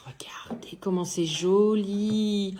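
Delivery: hushed, slow whisper. Real, not acted. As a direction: whisper in awe over the wand. Showing wand detail up close.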